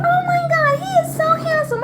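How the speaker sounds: very high squealing gush; mock a stranger fawning over a baby; high-pitched gushing elevator lady. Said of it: "this lady was like".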